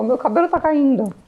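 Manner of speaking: mild imitation of crying mother. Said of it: "ela tava chorando".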